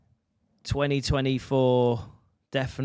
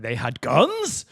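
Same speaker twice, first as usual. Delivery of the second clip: mock surprise; much slower than usual. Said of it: "I was like".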